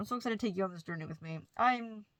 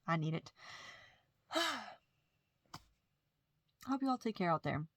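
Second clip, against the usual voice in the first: ordinary talk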